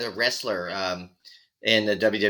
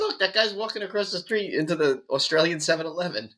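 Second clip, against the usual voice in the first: higher-pitched mock recruiting patter; eager film recruiter; 6 semitones higher than usual. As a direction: wave a passerby in, lightly acted. Imagined filmmakers recruiting a passerby.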